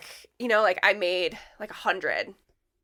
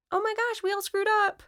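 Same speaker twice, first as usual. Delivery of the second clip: high-pitched acted dismay; mock group confession; 13 semitones higher than usual. Friends confessing mistakes to each other, mock dismayed.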